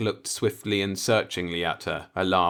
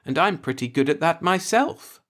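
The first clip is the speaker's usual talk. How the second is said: gaily; 10 semitones higher, 5 dB louder than usual. A narrator's reading. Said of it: "she said gaily".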